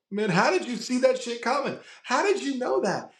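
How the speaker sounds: an amazed fan asking; excited fan voice. Retold or imitated. Fans asking in the comments.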